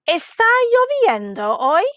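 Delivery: "Está lloviendo hoy" high on "lloviendo", swooping up on "hoy"; "Está lloviendo hoy" as an exaggerated question; rising question. Shown as a demonstration. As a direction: ask "Está lloviendo hoy" with a big final lift. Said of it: "that was another question".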